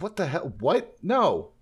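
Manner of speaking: high, mock-outraged objection; incredulous protest. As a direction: argue in exaggerated disbelief. Acting crew's protest at musician choice.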